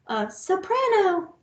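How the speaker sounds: teacher stepping the pitch down through the recorders. Shown as a demonstration. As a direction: lift the voice for the soprano. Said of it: "the next highest is the soprano".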